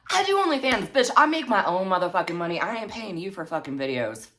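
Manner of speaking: high, loud indignant outburst; indignant rant. Real, not acted. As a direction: fire it off at her, high and forceful.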